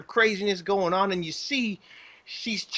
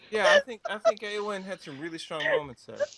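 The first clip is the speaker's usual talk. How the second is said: usual speaking voice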